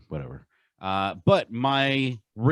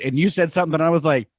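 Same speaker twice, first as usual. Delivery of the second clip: higher and louder, eager build-up; excited retelling; 8 semitones higher, 6 dB louder, faster than usual. Real, not acted. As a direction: rush into the story with excitement.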